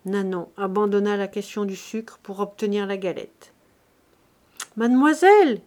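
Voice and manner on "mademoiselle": high, loud call through a window; raised, carrying voice, pitched up. A narrator's reading. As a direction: cry out to someone indoors. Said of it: "cria-t-elle par la croisée".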